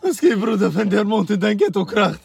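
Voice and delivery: loud, high-pitched shouted rapper impression. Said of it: "die beste ist auf jeden Fall die [name]-, [name]-Imitation".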